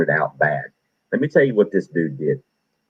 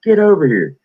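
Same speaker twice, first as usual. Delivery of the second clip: higher, louder barked command; drill sergeant order; 9 semitones higher, 4 dB louder than usual. Retold or imitated. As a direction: snap the order like a drill instructor.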